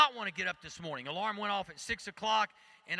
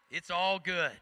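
ordinary talk, nothing marked

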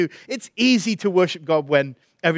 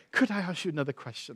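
hushed, careful request; gentle; 9 dB quieter, faster than usual. Retold or imitated. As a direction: drop the volume and ask kindly. Voices Heidi's gentle second question.

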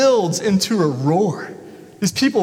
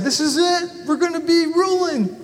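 higher, giddy mock triumph; eager disciples; 10 semitones higher than usual. Preacher imitates disciples dreaming of power, higher and giddy. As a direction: crow like disciples about to rule. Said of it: "They probably said".

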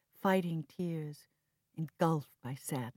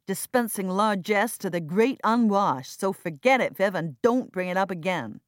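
loud, raised angry voices; heated argument; 5 semitones higher, 11 dB louder than usual. Acted. Siblings quarreling over the charity, heated and loud. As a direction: push back hard.

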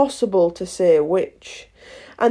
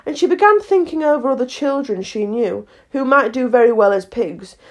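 ordinary delivery

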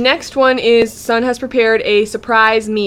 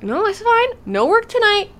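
much higher-pitched, breezy brush-off; mock reassurance; 11 semitones higher than usual. Husband promises wife a night off, high mock reassurance.

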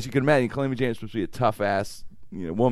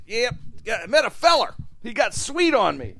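higher, lightly voiced Calamity Jane bragging; Calamity Jane telling; 12 semitones higher than usual. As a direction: tell it like a rough frontier woman, a bit higher.

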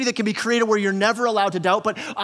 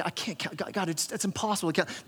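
overwhelmed Abram; 10 dB quieter, faster than usual. Pretend. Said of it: "Abram's like".

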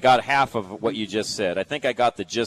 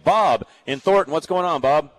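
upbeat caller intro; 6 semitones higher, 5 dB louder than usual. Real, not acted. Host introduces caller on air.

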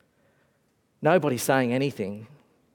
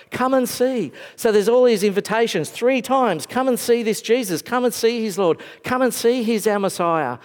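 high, bright calls of "Come and see"; eager invitation; 8 semitones higher, 3 dB louder than usual. Retold or imitated. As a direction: invite them excitedly. Excited invitation to see Jesus.

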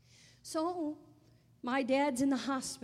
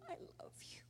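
barely voiced whisper; whispered sob; 16 dB quieter, much faster than usual. Daughter's last whispered I love you to her father. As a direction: breathe it out through tears. Repeated farewell fading to whisper.

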